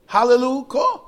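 ringing, high-pitched exclamation; exultant praise. Shown as a demonstration. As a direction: cry it out as one burst of praise. Praise as one word, demonstrated.

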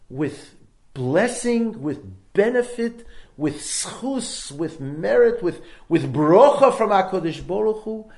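booming, impassioned preacher's voice; fervent preaching. Acted. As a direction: preach it out loud to the crowd. Preaching at full force.

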